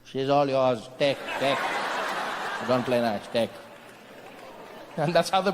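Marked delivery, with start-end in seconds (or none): 0.0-3.5 low-pitched, abrupt giving-in; surrendering Indian man. Acted. Indian man gives up the country, abrupt surrender. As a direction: hand it over in a low voice.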